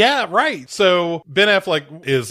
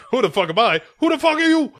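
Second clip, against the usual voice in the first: mock yell; 13 semitones higher, 4 dB louder, much faster than usual. Repeating the quarrel.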